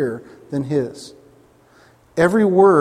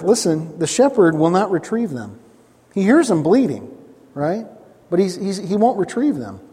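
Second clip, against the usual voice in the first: usual speaking voice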